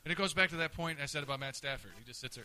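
ordinary delivery

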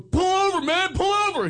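high loud pleading yell; mock yell. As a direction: shout at the driver to pull over. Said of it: "We're going".

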